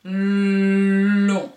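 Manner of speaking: "Melon" with the l held long and loud; prolonged l in "Melon"; l stretched. Shown as a demonstration. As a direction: draw out the l of "Melon". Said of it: "mes deux syllabes".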